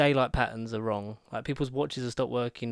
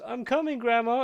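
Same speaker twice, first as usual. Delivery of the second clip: high, loud retold call to grandma; a mock cry high up calling grandma; mock Mindy call; 11 semitones higher, 5 dB louder than usual. Sheriff calling out to her grandma, high mock cry. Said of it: "She just goes".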